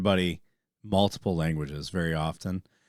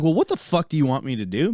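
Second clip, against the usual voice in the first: retold exasperated outburst, higher pitched; mock exasperation; 7 semitones higher, 5 dB louder, faster than usual. Retelling his reply to offended locals, exasperated. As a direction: throw it out as a frustrated protest. Retelling frustration with language snobs.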